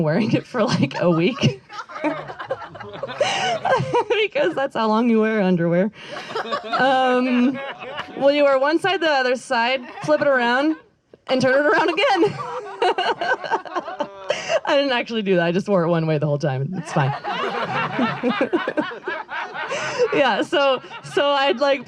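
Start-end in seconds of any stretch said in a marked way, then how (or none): 1.7-4.2 long high laugh; real laugh. Real, not acted. Admitting she wore it a week, laughing. 12.4-14.6 high rising giggle; real laugh. Real, not acted. Joking about flipping underwear, giggling. 17.2-20.1 long high laugh; real laugh. Real, not acted. Confessing she never flipped it, laughing.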